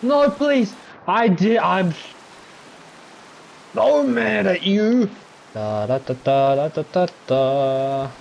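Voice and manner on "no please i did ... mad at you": high, loud petulant whine; whiny pleading. Real, not acted. Gamer pleading with a frustrating game, high whine. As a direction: beg then scold the game.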